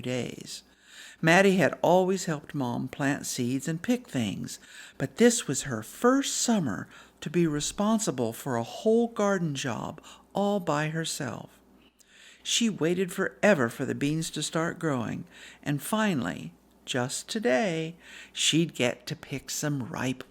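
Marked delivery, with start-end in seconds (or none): none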